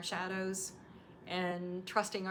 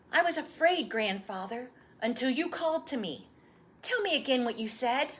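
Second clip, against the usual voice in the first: put-on lightly higher eager boy; an eager young boy begging for the story; young boy voice, a little higher; boy's voice; 5 semitones higher, 7 dB louder than usual. Boy asks again.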